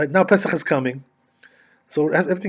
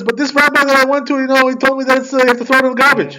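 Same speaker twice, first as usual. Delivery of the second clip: higher, louder and fast, pleading; upset lady; 10 semitones higher, 6 dB louder than usual. Retold or imitated. Woman objecting to the rabbi, high pleading protest. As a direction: protest in a higher voice, all in a rush.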